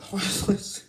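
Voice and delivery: words dissolving into a laugh. Real, not acted. Recalling the hard lesson phrase, breaking into laughter. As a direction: crack up on the last words. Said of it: "I laughed when I heard that".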